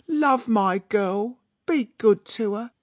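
high, slow, emotional pleading; tearful mama. A narrator's reading. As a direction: entrust your daughter with theatrical tears. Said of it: "crying with happiness".

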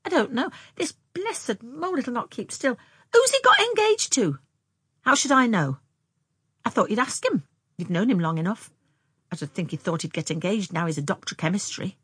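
put-on high, animated girls' exchange; flustered young sisters. Sisters arguing over Jimmy while the mole wriggles, animated. Sisters' quarrelsome dialogue voiced.

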